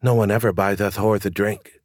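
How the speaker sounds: ordinary delivery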